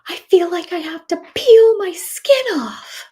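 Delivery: high, slow, breathy whimper; desperate whisper. Retold or imitated. Son to mom about the itch, a whispered cry. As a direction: squeeze it out like an itching, miserable kid. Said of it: "And he's like".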